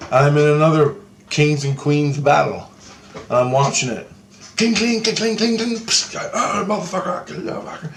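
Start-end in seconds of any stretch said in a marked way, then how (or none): 4.6-5.7 high-pitched rapid metallic clinking; imitated sword clashes. Imitates clashing weapons in battle. 5.8-7.9 high, strained imitation of fighters; hammed-up fighter's cry, trailing off softer; mock battle cries.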